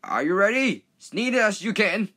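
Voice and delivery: high, loud, slow urging; eager cue. Acted. Driver urging Henry to sneeze, eager. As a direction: build him up to sneeze.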